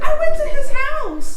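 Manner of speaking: put-on high, slow child voice; little girl voice. Mother imitating her daughter's claim, high child voice. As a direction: say it like a proud toddler. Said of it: "She's like".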